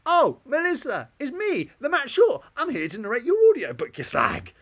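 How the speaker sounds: high, loud, over-the-top greeting; hammed-up shouted hello; personalised greeting. Shown as a demonstration. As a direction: bellow a mock-grand introduction. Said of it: "with a personalised greeting".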